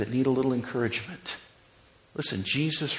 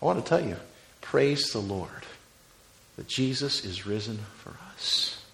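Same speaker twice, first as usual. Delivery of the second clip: ordinary talk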